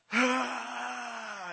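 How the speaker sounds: long, high, breathy cry; big gasp. Retold or imitated. Young man rolling over during deliverance, big gasp. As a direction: let out a drawn-out imitated gasp. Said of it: "he does this big gasp".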